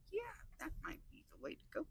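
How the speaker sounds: quick hushed whisper; whispered aside. Real, not acted. Vlogger whispering a plan to herself, hushed whisper. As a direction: mutter it to yourself fast.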